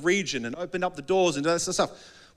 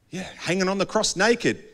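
ordinary talk, nothing marked

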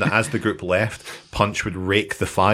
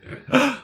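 sharp, high theatrical gasp; mock gasp. Comic mimicking a once genuine gasp, mock gasp. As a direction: gasp in pretend shock. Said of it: "the only gasp. Before it was a genuine thing, now it's like".

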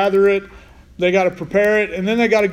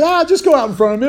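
breezy reassurance; 7 semitones higher, 3 dB louder, faster than usual. Acted. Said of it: "God said".

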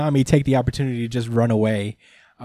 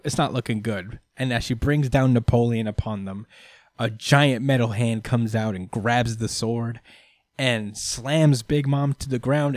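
usual speaking voice

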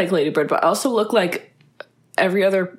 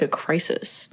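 ordinary talk, nothing marked

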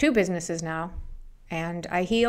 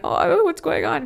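bewildered crying; 10 semitones higher, 5 dB louder than usual. Retold or imitated. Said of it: "and I'm crying".

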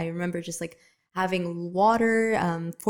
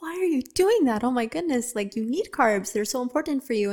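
higher, alarmed coaching reply; surprised trainer; 5 semitones higher, 3 dB louder than usual. Retold or imitated. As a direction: sound shocked they cut carbs. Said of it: "And I'm like".

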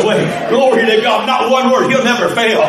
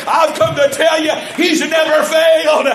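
preacher's shout climbing higher toward the peak; full-force preaching; 6 semitones higher than usual. Acted.

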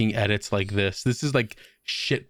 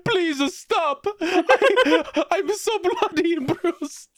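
high, laughing fake sob as a beaten hero; hammed-up crying plea; mock crying Folgore; much slower than usual. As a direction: whimper it out in a high, hammed-up cry through laughter. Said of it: "jumps up every time crying".

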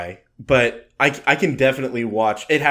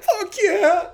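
high joyful yell; triumphant cheer. Retold or imitated. Watching Air Bud win, a high triumphant cheer. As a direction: cheer it like a winning shot. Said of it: "you're like".

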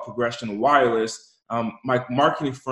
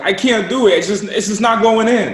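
put-on high, loud whine of a struggling kid; whining child; 9 semitones higher, 7 dB louder than usual. Four-year-old complains to uncle figure about the buckle, whining. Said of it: "this is what he does".

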